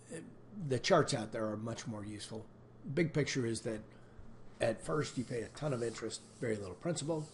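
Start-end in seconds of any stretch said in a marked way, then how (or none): none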